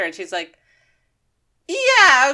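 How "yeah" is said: high, loud, stretched yeah; exaggerated high-pitched hedging; reluctant agreement. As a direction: blurt a drawn-out mock-agreeable yeah. Retold awkward sudden agreement.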